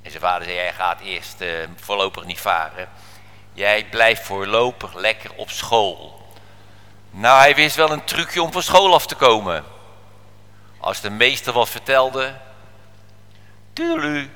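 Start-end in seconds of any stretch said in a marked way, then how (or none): 0.6-6.2 low, soft, firm father's voice; quietly firm dad laying down the law; stern father. Acted. Father telling his boy he stays in school, quietly firm. 13.7-14.3 high little singsong; light, high-pitched toodle-oo; airy tuning-out. Acted. Boy drifting off while the teacher talks, airy and high.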